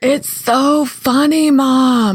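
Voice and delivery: put-on high, slow, drawn-out kid plea; whiny eager kid. Kid insisting mom watch a funny video, high and drawn out. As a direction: stretch it out like a kid begging.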